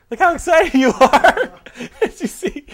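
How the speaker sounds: high, loud and slow teasing; excited teasing. Real, not acted. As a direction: tease him with high glee.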